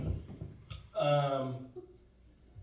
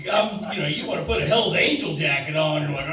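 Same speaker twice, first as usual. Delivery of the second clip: ordinary delivery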